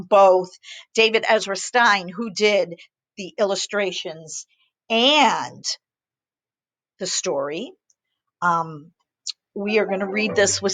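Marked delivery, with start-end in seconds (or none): none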